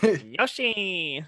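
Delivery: high, nasally cartoon cry; Yoshi. Shown as a demonstration. Host retrying the character, nasally high voice. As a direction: stretch the last vowel through the nose. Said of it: "It's really nasally".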